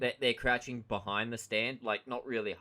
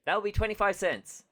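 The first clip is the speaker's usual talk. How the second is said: much higher chirpy salesman line; SpongeBob's chirp; 10 semitones higher, 3 dB louder than usual. Retold or imitated. SpongeBob surprising Squidward at the stand, chirpy. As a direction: pop in cheerfully with the price. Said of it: "He just goes".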